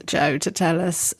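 ordinary talk

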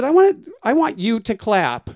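demanding. Retold or imitated. Performer on stage calling out the late guest, insistent. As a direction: insist she claps, pushing hard. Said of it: "I said".

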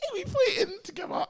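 high, cracking laugh-voice; laughing through it. Retold or imitated. Retelling to friends, breaking into laughter.

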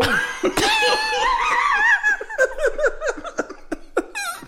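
shrieking giggle; high squealing laugh. Real, not acted. Breaks up after impression.

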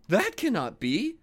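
high timid lamb. A narrator's reading. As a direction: plead in a high little lamb voice. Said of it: "said the lamb".

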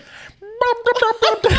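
high wailing loon imitation; loon call. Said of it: "Let me try".

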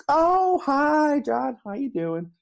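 very high, loud sing-song greeting; hammed-up bubbly phone voice, pitched high; cheery coworker. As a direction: gush like you know him well. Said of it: "she's like".

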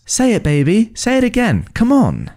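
put-on high-pitched baby-talk urging; excited coaxing. A narrator's reading. Mother urging baby to repeat a word, excited coaxing. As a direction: coax a baby eagerly.

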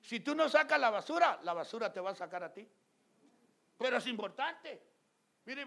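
loud, high, insistent preacher's questioning; preaching at full force. Acted. Pastor drilling his church, preaching at full force. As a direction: hammer each question at the congregation.

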